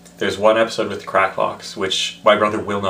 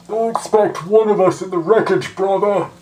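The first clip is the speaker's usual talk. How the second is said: high, louder, muffled theatrical villain; Bane impression; 10 semitones higher, 5 dB louder than usual. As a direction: boom through a mask. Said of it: "You have to muffle it a bit".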